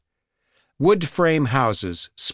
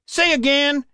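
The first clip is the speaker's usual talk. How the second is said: sharp call back; 3 dB louder than usual. A narrator's reading. Cop shouting back.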